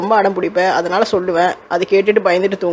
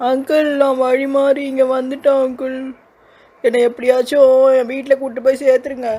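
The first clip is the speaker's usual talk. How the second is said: put-on a lost child begging a stranger for help; lost little girl; 5 semitones higher than usual. Little girl begs the uncle to take her home, tearful plea.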